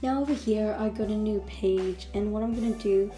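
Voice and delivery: ordinary talk, nothing marked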